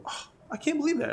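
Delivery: high, fast, gushing fake sympathy; hammed-up indulgent dad; mock sympathy. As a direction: play along with the child's story, all sympathy. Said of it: "me, would go".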